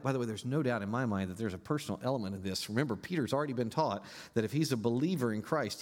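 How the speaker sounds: ordinary talk, nothing marked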